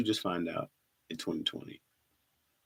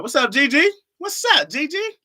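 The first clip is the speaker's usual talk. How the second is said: much higher, louder hype greeting; excited greeting; 8 dB louder than usual. Real, not acted. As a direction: greet a viewer with big energy. Greeting a viewer in chat.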